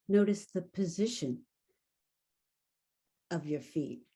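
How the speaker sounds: guided meditation. Acted. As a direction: lead them into stillness, unhurried. Leads a guided awareness exercise.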